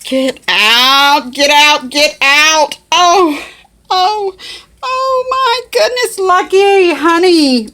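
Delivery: high, loud, thrilled squeals; real overjoyed squealing; squealing excitement. Real, not acted. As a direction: shriek it out with pure delight. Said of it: "I'm so excited".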